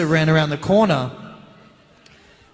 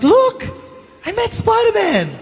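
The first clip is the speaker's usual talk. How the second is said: very high, loud mock-child squeal; excited little boy; 7 dB louder than usual. Boy bragging to his mum about meeting Spider-Man, excited. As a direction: impersonate a thrilled kid, voice shooting up. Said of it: "he's like".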